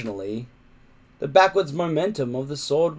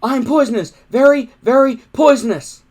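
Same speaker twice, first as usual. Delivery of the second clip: loud, high frantic cry; high panicked yelling; desperate shout; 12 semitones higher, 12 dB louder than usual. Acted. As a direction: shout the bluff at the top of your voice. Boy bluffing a monster.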